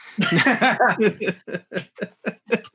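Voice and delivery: bright burst of laughter; laughing. Real, not acted. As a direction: laugh out loud at the joke. Laughing at a joke.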